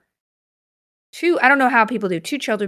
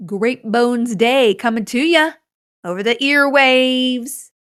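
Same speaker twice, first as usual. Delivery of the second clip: mock announcer; 4 dB louder, slower than usual.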